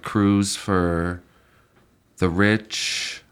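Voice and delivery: ordinary talk, nothing marked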